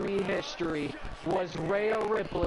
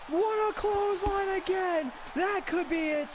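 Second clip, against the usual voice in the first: rising, much higher excited call; 12 semitones higher, slower than usual. Acted. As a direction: call the three-count with excitement. Calling the pin count.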